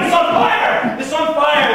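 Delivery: loud, high cry of fire; urgent acted shout; shouting alarm. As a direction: shout it twice like a crowd raising the alarm. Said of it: "they're shouting".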